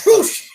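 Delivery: high, loud whoosh noise; imitated whoosh. Co-host mimics Robert Carradine as villain launches, imitated whoosh. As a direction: burst out a cartoon whoosh. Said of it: "Robert Carradine goes".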